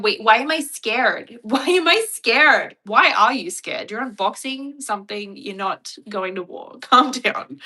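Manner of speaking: loud, exasperated mock influencer rant; mock an over-dramatic influencer, then scold them loudly; hammed-up influencer mockery. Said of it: "especially when other influencers online do, like, the".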